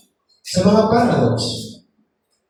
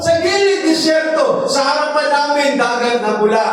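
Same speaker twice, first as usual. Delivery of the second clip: high, impassioned preaching of the crying Moses; preaching at full force; 4 semitones higher than usual. Acted. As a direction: lift the voice and plead as Moses at the sea. (masidhing pangangaral)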